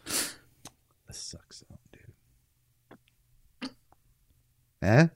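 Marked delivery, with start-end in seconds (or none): 0.1-3.7 slow breathy whisper. Acted. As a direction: whisper it slowly. Quoted movie line.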